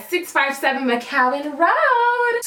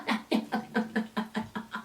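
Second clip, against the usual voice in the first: soft laugh; 3 semitones lower, 13 dB quieter than usual. Real, not acted. Laughing at end of rant.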